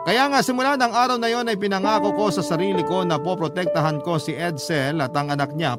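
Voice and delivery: usual speaking voice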